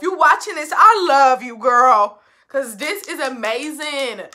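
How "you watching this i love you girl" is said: loud shout-out. Real, not acted. Calls out to Granny.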